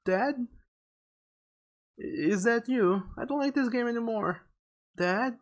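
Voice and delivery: put-on high, uneasy little-boy voice; young Mikey. Scared child in the woods seeking his father, high and uneasy. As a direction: call out timidly, pitched well up. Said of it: "goes young Mikey".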